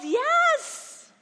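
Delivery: put-on high, loud children's yell; kids yelling. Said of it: "The kids paused for a moment and yelled".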